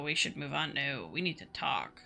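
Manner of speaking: usual speaking voice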